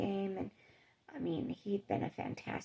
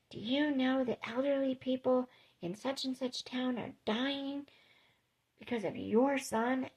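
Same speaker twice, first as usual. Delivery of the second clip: retold telling-off, voice raised in pitch; mock angry scolding; 6 semitones higher than usual. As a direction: imitate an accusing doctor, pitched higher. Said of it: "reamed me".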